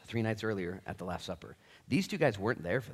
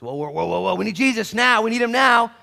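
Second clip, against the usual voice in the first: louder, higher urgent prayer; mock desperate call for help; building urgent praying; urgent plea; 12 semitones higher, 14 dB louder than usual.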